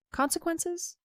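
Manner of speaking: incredulous repeat, rising high; stunned echo. A narrator's reading. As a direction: throw the word back in shock, pitch way up. Shocked echo of captain's word.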